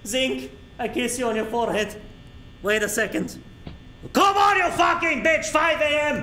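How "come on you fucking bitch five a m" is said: loud, high furious yell at the game; frustrated gamer yelling at the clock; angry shout. Real, not acted. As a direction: scream at it to finish.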